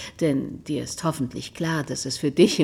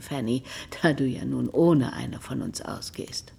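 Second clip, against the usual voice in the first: ordinary talk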